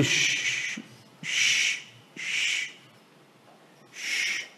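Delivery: repeated hissed "shh", stuck before the word; comic stall on a fading "shh"; stuck shushing sound. Retold or imitated. Cannot get the word quit out.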